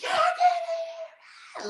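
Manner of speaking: stretched, high laughing shout; yelling. Retold or imitated. Mother retelling how she yelled at her kids, stretched laughing shout. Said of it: "So now I'm yelling".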